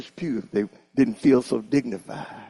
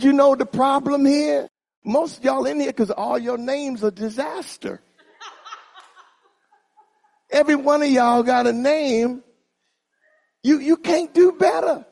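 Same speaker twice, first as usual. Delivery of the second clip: higher, louder preaching rebuke; 13 semitones higher, 4 dB louder than usual. Real, not acted. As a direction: call them out from the pulpit. Preacher rebukes the youths loudly.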